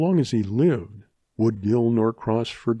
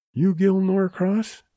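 higher, slow feeble voice; weak wounded youth; 9 semitones higher, 3 dB louder, slower than usual. A narrator's reading. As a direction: ask weakly, wounded and fevered. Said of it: "he asked weakly".

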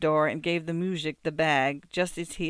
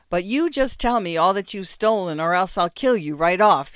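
loud, threatening shout; ferocious yell; 3 semitones higher, 9 dB louder than usual. Acted. Muzhik threatening to kill the beggar, yelling ferociously. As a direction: roar the threat at him. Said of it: "he yelled ferociously".

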